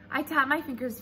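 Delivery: plain reading voice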